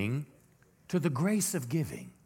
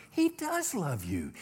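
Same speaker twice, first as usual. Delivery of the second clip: normal talking voice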